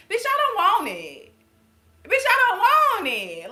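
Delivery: shouting refusal. Real, not acted. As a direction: yell it like you're done with it. Rant about love not being pain.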